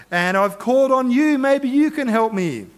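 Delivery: hammed-up desperate appeal, pitched high; acted plea. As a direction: beg for help as a last resort. Preacher acts Saul begging for help.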